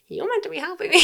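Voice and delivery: high, rising mock protest; retold, high-pitched appeal; incredulous protest. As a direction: protest it high, between laughs. Retold protest amid own laughter.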